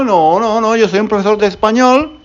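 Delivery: ordinary talk, nothing marked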